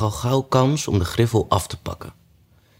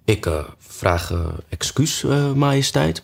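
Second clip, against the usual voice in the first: slow, faltering start with many ehs; hesitant apology; 6 semitones higher than usual. A narrator's reading. Hatter excusing himself to the king, hesitant. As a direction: falter between words, feeling for the next.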